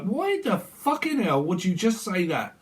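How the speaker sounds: acted indignant rant, rising high; indignant blame. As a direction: scold someone in exasperated, high-pitched mock outrage. Voicing someone blaming another.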